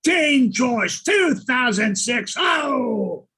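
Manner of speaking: loud, slow, drawn-out shout; announcer yell, slow; big shouted line. Shown as a demonstration. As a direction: belt it like an award-show opener. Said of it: "how did you deliver it?".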